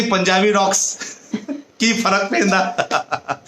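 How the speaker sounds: slow words broken by laughter; amused, laughing quote. Real, not acted. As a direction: laugh your way through the catchphrase. Amused aside quoting a Punjabi saying.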